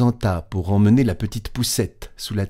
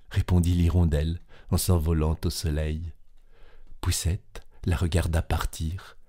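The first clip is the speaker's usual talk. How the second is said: plain reading voice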